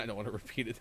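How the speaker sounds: ordinary talk, nothing marked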